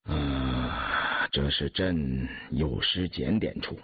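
slow, low, regretful admission; heavy, drawn-out imperial sigh. A narrator's reading. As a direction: admit fault ponderously, dropping well down in pitch. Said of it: "雍正低头喀叹道".

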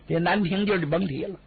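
ordinary delivery, nothing marked

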